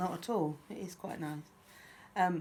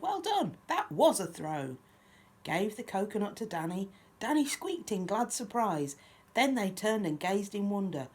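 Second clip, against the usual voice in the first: lively, lilting picture-book reading; children's story narration; 4 semitones higher, 5 dB louder than usual. A narrator's reading. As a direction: read a rhyme aloud to little ones. Reads picture book aloud.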